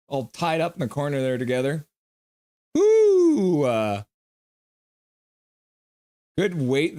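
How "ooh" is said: long, high whoop of reaction; loud high "ooh". Real, not acted. Commentator reacting to the play, loud high ooh.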